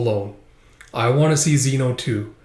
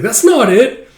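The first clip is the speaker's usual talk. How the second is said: upset insistence; 10 semitones higher, 8 dB louder, slower than usual. Acted.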